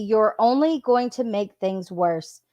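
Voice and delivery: ordinary talk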